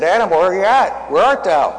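loud, high call to someone out of sight. Retold or imitated. God walks into the garden looking for Adam, calling out.

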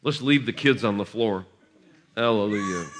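usual speaking voice

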